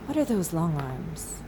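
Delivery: usual speaking voice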